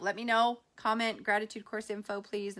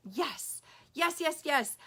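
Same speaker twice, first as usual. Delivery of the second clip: high, eager string of yeses; retold burst of eagerness; excited yes; 7 semitones higher, slower than usual. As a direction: jump at the offer, imitating your own delight. Retelling her eager yes to mother-in-law.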